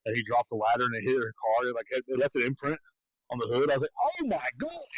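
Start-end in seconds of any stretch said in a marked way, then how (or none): 4.0-4.8 shocked. Retold or imitated. Ladder dropped on car.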